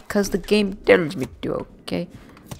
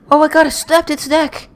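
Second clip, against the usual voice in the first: shocked; 12 semitones higher, 9 dB louder than usual. Real, not acted. Reacting to own neck snap.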